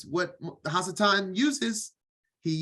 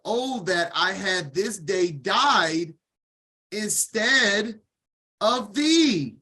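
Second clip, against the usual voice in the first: anguished lament; 3 semitones higher, slower than usual. Shown as a demonstration. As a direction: cry it out as Sarah mourning her son. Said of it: "Your words are powerful".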